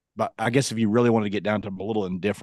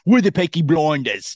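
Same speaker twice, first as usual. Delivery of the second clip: higher, louder caricature of Cockney; mock Cockney accent; 4 semitones higher, 5 dB louder than usual. Imitating hard-to-understand TV characters, exaggerated Cockney. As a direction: put on thick, mushy Cockney. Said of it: "They're like".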